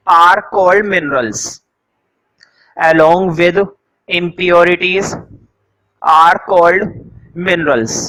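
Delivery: slow, measured note dictation. Acted. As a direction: dictate phrase by phrase, slowly, with pauses. Dictates notes slowly to students. (धीरे-धीरे रुक-रुककर लिखवाते हुए)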